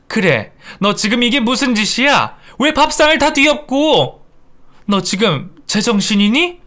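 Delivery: high, acted outrage; indignant scolding. Mother-in-law scolding daughter-in-law for upturning the table, indignant.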